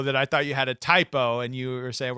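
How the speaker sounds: usual speaking voice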